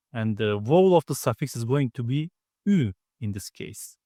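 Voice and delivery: usual speaking voice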